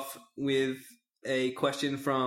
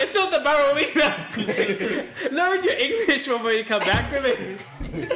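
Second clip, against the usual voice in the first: high, loud, drawn-out ridicule; hammed-up jeering holler; mocking yell; 12 semitones higher, 7 dB louder, slower than usual. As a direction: yell down your rival's mangled words. Mocks rival's garbled words, yelling.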